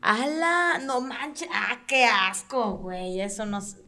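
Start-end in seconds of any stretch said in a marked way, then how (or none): 0.0-1.5 high shocked exclamation; shocked outburst. Real, not acted. Reacting to disgusting story. 1.5-3.2 slow drawn-out disgust. Real, not acted. Reacting to disgusting story.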